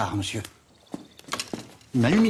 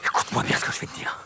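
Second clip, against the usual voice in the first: urgent low whisper; faster than usual. Acted. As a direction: whisper it close, fast. Secret advice told close.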